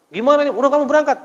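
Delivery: high, loud flustered cry; panicked older brother. Retold or imitated. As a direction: sound caught out and panicking. Brother panics after spilling goods. (kakak panik, suara tinggi dan keras)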